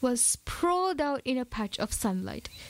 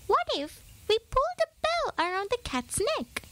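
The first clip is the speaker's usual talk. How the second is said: high eager mouse voice; explaining little mouse; 11 semitones higher than usual. A narrator's reading. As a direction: lay out the plan, squeaky. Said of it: "Pip explained".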